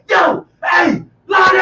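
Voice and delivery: a retold call across the club let-out. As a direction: yell a name to get his attention. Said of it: "I heard one dude say".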